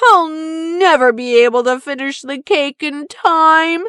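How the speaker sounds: a small friend wailing that everything is ruined; despairing Bongo. Acted. Bongo despairs over the cake.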